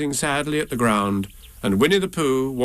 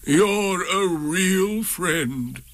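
dragging, higher donkey voice; slow gloomy donkey; 6 semitones higher, much slower than usual. A narrator's reading. Eeyore thanks Pooh, slow and mournful.

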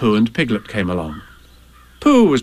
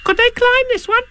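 tiny, very high squeaky youngster; high-pitched eager little voice; Roo's voice. Acted. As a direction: squeak the question like a curious little one. Roo questions Tigger.

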